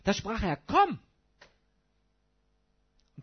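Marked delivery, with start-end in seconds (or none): 0.6-1.0 high, loud ringing command; commanding call. Acted. Jesus calling Peter out of the boat, loud command.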